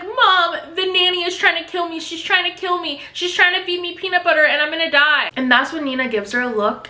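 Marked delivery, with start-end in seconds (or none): 0.0-5.3 put-on high-pitched, hysterical little-girl complaint; tattling child. Little girl telling Mom on the nanny, high mock panic. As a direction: put on a melodramatic kid crying foul.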